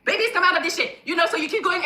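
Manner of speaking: ordinary talk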